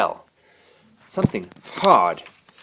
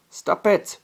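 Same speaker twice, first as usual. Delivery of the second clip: shouted; 11 semitones higher, 3 dB louder than usual. A narrator's reading. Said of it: "Charlie shouted at Alberic".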